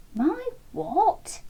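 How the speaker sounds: puzzled, caught off guard; Chunky baffled by his friends' advice; confused surprise. Acted. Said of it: "Chunky was even more confused now".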